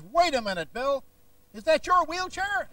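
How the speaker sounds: hammed-up showman stopping the man; W V Grant imitation. Healer stopping the man at the wheelchair, high hammed-up preacher. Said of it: "Grant grabs him and says".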